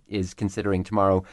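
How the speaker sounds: normal talking voice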